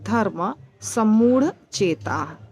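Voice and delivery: "धर्म सम्मूढ़ चेता" said slowly with each word apart; slow separated words. Shown as a demonstration. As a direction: break the compound, say it slowly. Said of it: "अलग-अलग बोल देंगे".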